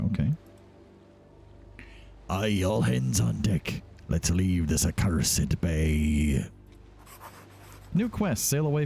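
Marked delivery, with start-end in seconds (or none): 2.3-6.5 low, slow pirate captain voice. Acted. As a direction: bellow orders like a ship captain. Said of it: "a pirate voice for this".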